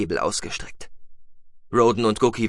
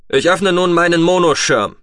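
loud, raised announcement; projected, higher-pitched declaration; calling out; 8 semitones higher, 9 dB louder than usual. A narrator's reading. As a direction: call it out high and strong. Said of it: "rief Atlan".

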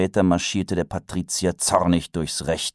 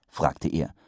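usual speaking voice

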